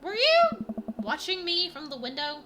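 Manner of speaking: put-on high, bright teenage daughter; chirpy voice for a teen girl. Voicing teenage daughter Amanda.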